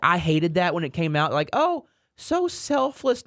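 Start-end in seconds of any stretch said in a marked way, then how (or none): 1.5-3.2 high, slow, sneering oh so selfless; sarcastic mock praise. Host mocking praise of the players' restructure, sarcastic.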